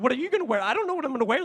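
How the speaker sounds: loud, rushed fretting; panicked excitement. Retold or imitated. Two fans panicking over outfits before meeting the band, excited. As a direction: fret out loud like a nervous fan.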